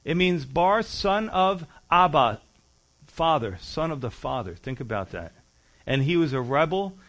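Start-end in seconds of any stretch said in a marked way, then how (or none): 0.8-4.6 slowed, weighty explanation of the name; slow emphasis. Shown as a demonstration. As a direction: son of Abba spelled out slowly. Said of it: "You know what his name means".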